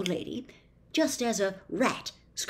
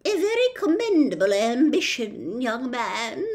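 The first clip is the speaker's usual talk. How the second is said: put-on higher, louder old lady voice; small voice; 5 semitones higher, 5 dB louder than usual. A narrator's reading. The witch praising Milo, higher old lady voice. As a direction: praise from the shadows. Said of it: "said a small voice".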